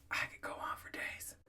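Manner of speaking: hushed, breathy under the breath; whispered aside. Real, not acted. Telling listeners he could go on for days, in a whisper. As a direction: let it slip as a secret aside.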